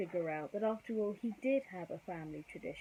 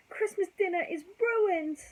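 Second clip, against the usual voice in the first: said sadly; 11 semitones higher, 7 dB louder than usual. Acted. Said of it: "Lady said sadly".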